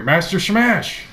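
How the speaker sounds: high, loud call to wake him; urgent call. Acted. Old servant wakes the young master, calling out. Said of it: "you hear".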